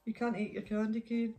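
usual speaking voice

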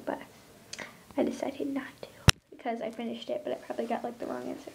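very soft, whispered confession; soft sheepish whisper. Real, not acted. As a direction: whisper it like a secret. Whispered confession about homework.

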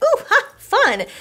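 hammed-up girly glee; playful squeal. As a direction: squeal it like a delighted kid. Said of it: "So it's like".

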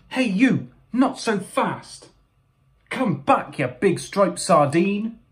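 high, drawn-out hero's challenge; challenging call. Acted. Mo calls after the fleeing thief.

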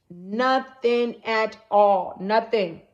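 very slow, drawn out Nothing at all; emphatic. Real, not acted. Telling viewers God was gone, very slow. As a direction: stretch Nothing at all and lean on Nothing. Stressing God's absence.

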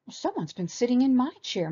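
high mama bear yelp. A narrator's reading. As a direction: yelp it in dismay. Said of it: "Mama Bear yelped".

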